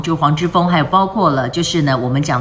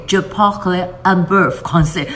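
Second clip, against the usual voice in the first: a bit of French; slower than usual. Shown as a demonstration. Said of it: "我只会讲一点点法文".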